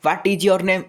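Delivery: loud, high, forceful question; full force. Shown as a demonstration. Teacher showing the wrong way to ask, loud and forceful throughout. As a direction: blast out the whole question at one level. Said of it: "ମୁଁ ଏମିତି ପୁରା ଜୋରରେ କହୁଛି".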